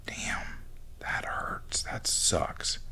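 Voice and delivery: quiet hushed empathy; soft whisper. Real, not acted. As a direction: breathe it out gently.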